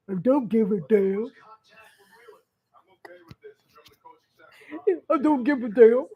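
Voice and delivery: lightly voiced husband, shrugging it off with a laugh; a hint of the husband brushing it off; mock dismissive husband. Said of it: "he says".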